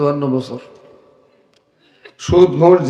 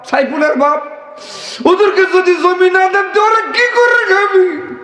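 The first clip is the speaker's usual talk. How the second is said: high pleading wife's voice, rising and slowing; pleading mother; 14 semitones higher than usual. Retold or imitated. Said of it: "মাও এখন টপলাস করে বলতেছেন".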